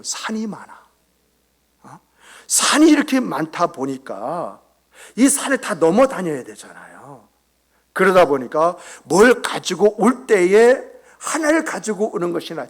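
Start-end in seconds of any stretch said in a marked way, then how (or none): none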